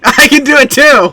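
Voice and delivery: laughing and loud, high. Real, not acted. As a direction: laugh it out, proud. Laughing boast after joke.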